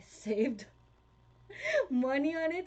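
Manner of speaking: usual speaking voice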